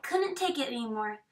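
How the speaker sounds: plain reading voice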